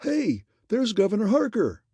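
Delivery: high, loud call across the crowd; yelled. A narrator's reading. Reporter spotting the governor in the mob, high loud yell. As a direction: shout to the other reporters. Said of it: "someone yelled".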